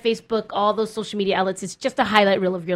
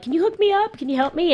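pleading friend; 9 semitones higher than usual. Retold or imitated. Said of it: "people are like".

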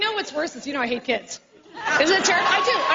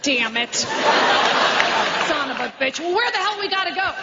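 hammed-up cranky old mother cursing on the phone; drawn-out exasperated mom, swear words stretched slowly. Mom on the phone with daughter, cursing in mock outrage. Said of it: "she goes like this".

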